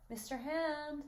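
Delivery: put-on boy calling out. Boy at the window calling to a visitor, high call. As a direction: call a name in a high, boyish voice. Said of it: "a boy's voice is heard calling".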